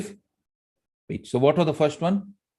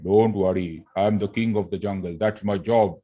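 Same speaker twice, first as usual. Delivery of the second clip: lower, deep kingly voice; baritone lion; 4 semitones lower than usual. Acted. Lion reassuring the little mouse, in a deep voice. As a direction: rumble it low like the king of the jungle. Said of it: "Baritone, right?".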